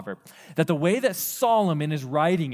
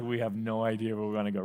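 quick, lower, shrugging reply; clueless family; 5 semitones lower, 5 dB quieter, faster than usual. Retold or imitated. Family answering dad, lightly voiced quick shrug. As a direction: toss it off fast and vague.

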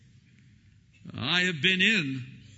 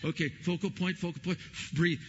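coaching breathing; 2 semitones higher, faster than usual. Retold or imitated. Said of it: "I'm trying to look at the machine".

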